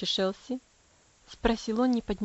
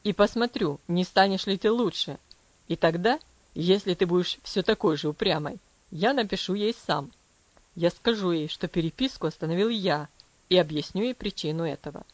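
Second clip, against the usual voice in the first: low, firm father's voice; measured warning from above; stern father; 4 semitones lower, 4 dB louder than usual. Acted. Father threatens daughter. (низким строгим голосом отца)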